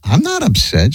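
high-pitched retold protest; mock protest. Husband reassuring his crying wife, mock protesting. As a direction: insist in a strained high voice.

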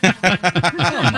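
high mock indignant cry; fake-offended squeal at an old friend; mock outrage. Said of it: "I'm like".

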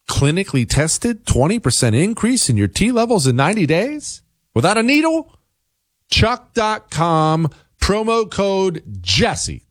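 high-pitched, hammed-up sales read; sponsor ad pitch. Host selling Chalk supplements to listeners, high-pitched salesman patter. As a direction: stretch the web address out high, like a jingle.

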